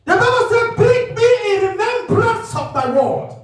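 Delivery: loud, high, chanting scripture; fiery preaching. Real, not acted. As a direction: ring the verse out like a cry. Preaching at full force.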